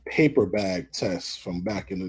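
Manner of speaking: ordinary delivery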